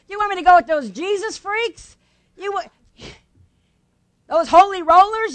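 high-pitched, incredulous protest; scornful resistance. Acted. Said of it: "when you hear that resistance".

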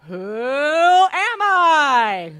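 slow, booming, drawn-out title call; game show announcer. Shown as a demonstration. Host booming the game show title to the Bible study group. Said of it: "I worked on different emphasis".

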